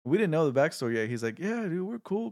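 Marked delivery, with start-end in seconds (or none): 1.4-2.3 high casual. Retold or imitated. Big guy answers calmly.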